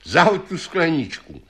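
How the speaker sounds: high-pitched order; calling out. A narrator's reading. Magic horse telling Janko to throw the glass, calling out. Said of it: "zvolal tátoš".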